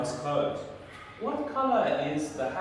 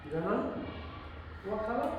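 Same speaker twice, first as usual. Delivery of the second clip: ordinary talk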